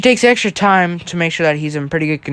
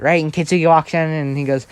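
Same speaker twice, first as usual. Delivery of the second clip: ordinary delivery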